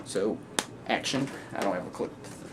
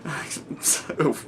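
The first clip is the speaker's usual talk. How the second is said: stifled laughter; 3 semitones higher, slower than usual. Acted. Joker apologises through laughter.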